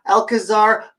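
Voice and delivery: plain reading voice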